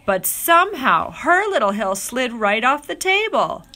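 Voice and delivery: ordinary delivery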